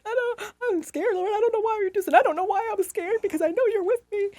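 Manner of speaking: high, tearful pleading to God; re-live the scared, teary prayer; retold crying prayer. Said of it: "I literally was crying".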